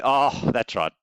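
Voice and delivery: ordinary talk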